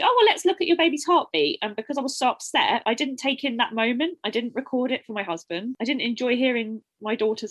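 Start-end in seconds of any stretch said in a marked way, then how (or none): 0.0-1.6 higher, louder imitation of a brisk midwife; breezy subject change. Midwife changing the subject on an upset patient, imitated breezy brush-off. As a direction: lift pitch and volume to move briskly on.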